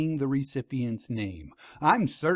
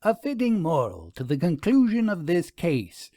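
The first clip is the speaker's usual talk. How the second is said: a lightly voiced prim lady, a bit higher, self-satisfied; surmising; 5 semitones higher, 3 dB louder than usual. A narrator's reading. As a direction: conclude the case with arms crossed. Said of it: "Vera crossed her arms before surmising".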